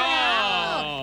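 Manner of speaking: cheering. Real, not acted. Cheering the caller's line.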